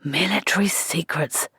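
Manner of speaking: unvoiced whisper; whispered thought. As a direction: breathe the words to yourself. Polly thinking to herself.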